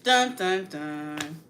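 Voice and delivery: mock suspense sound effect; dramatic sting. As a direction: hit it like a TV drama cue. Mock drama over serious topic.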